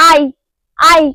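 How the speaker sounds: আই brought up from inside the throat; throat articulation. Shown as a demonstration. Teacher demonstrating the letter I to learners, throat articulation. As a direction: sound out each আই from within the throat. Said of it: "গলার ভিতর থেকে আসবে".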